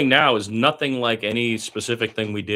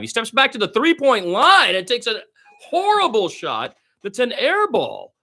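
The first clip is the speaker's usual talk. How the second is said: much higher and louder, rising in disbelief; excited call; 13 semitones higher, 7 dB louder than usual. Acted. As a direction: call the horrible shot in disbelief. Bad shot during live call.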